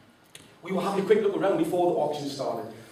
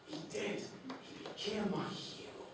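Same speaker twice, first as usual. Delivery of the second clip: much softer, tender tribute; 4 semitones higher, 12 dB quieter than usual. Real, not acted. Speaker honours his dad before the audience, soft and tender. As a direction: say it softly, full of love.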